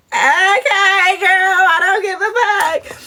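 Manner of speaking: high, singsong brush-off; hammed-up high, uncaring lilt; mock indifference. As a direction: sing out a cheerful dismissal. Acting Michelle's uninterested reply.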